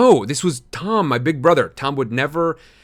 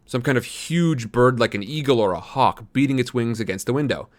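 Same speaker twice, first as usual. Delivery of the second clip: usual speaking voice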